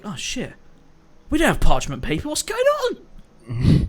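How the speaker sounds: squeaky panicked voice rising high; mock panic. Girls panicking over notes.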